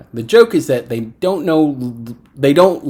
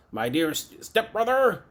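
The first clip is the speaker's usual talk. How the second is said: soft mock villain greeting, swooping higher; lightly voiced sneering villain; Juggernaut; 6 semitones higher, 6 dB quieter than usual. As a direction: imitate a villain's familiar address. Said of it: "as he calls Charles".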